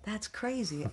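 a touch of awestruck excitement; retold excitement. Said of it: "I was so excited".

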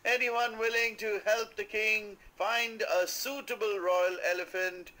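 high, loud town crier call; loud announcement. A narrator's reading. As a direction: proclaim it to the whole street. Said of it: "announcing loudly in the streets".

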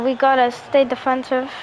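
ordinary delivery

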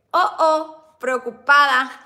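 loud, drawn-out mock worry; reading the emoji out. Reading her diary emoji aloud to viewers, mock worried. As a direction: ham up the worried face.

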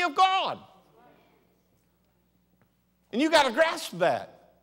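loud, high, rising sermon climax; preaching at full force. Acted. Pastor to congregation, preaching loud and high. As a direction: drive the point home to the congregation.

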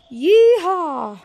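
loud high cowboy whoop; yeehaw. Real, not acted. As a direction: whoop it like a rodeo rider. Riding the shark.

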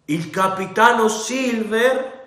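high, slow rising sneer on capitano; mock the title with disbelief; scornful question. A narrator's reading. Captain scoffs at the title.

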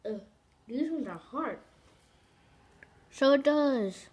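speaking with candy in teeth; talking through sticky caramel; biting into candy. Real, not acted. Seen 3: hand holds candy at teeth, bites and pulls, strand stretching.

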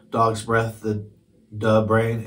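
ordinary delivery, nothing marked

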